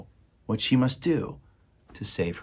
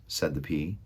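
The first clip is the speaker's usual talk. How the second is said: plain reading voice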